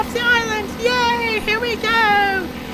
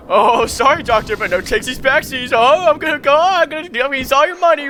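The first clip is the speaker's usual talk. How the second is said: lower, louder cheeky gloating; mocking thief; 5 semitones lower, 3 dB louder than usual. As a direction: taunt him as you run off. Thief mocks the Doctor.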